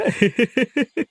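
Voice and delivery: high gleeful chuckle; teasing laugh. Acted. Uncle teasing the shy groom, gleeful chuckle.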